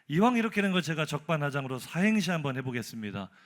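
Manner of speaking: normal talking voice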